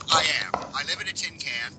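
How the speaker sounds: ordinary delivery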